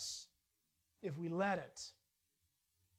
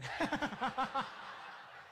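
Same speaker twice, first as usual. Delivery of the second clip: a short breathless chuckle; real amused laugh under the breath; breathy laugh; 4 semitones higher than usual. Real, not acted. Laughing at lying newscasters.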